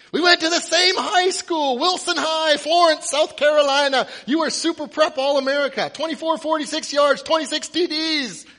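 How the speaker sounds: high, loud stream of shouted facts; yelling. Acted. Fan reciting the quarterback's school record, yelling. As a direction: shout the list like a frantic fan.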